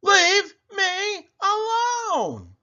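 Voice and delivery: put-on old woman shouting back at her family as she leaves, high and drawn out; shouted. A narrator's reading. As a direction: draw it out long and high like a fed-up old woman. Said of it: "the old woman shouted".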